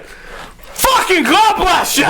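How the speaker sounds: high, slow mock outburst; so pissed. Man to Sean after an hour of maneuvering, mock-pissed cry. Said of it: "he was so pissed".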